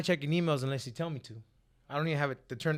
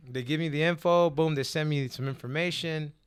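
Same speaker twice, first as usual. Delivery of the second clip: ordinary talk, nothing marked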